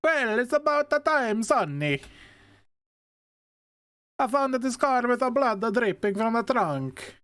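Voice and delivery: put-on high, slowed heavy Italian accent. Officer Gillespie briefing Sonny at the car, in a heavy Italian accent. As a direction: ham up a thick Italian accent. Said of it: "It is heavy Italian accent".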